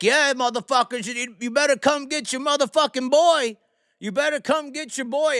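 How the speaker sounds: high, loud mock yell; imitating man going off. Drunk man taunting cops as they come over, imitated defiant holler. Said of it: "he went off on all these cops".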